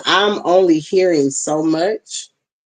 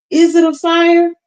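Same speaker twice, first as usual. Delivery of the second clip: "Is it a fire?" asked much higher, louder and slower; mock alarm; 11 semitones higher, 6 dB louder than usual. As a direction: play up fake panic about a fire. Joking about mic on fire.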